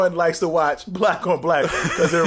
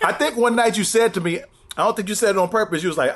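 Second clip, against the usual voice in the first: ordinary talk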